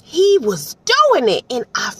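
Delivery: very high, loud gushing with laughs. Real, not acted. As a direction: rave about him, squealing with delight. Gushing about narrator's voices.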